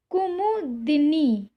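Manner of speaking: कुमुदिनी said slowly, every syllable clear; कुमुदिनी drawn out syllable by syllable, दि clear; careful spelling-out. Shown as a demonstration. Teacher slowly reads कुमुदिनी for the class. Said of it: "सही तरीका है लिखने का वो है कुमुदिनी".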